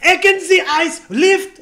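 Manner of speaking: high, very loud mock German; mock German shouting. Reading the helicopter manual in German to him, mock shouting.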